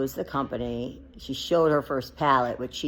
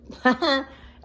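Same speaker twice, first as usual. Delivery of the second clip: scornful laugh; 11 semitones higher, 6 dB louder than usual. Real, not acted. Mocking people who profit from hate.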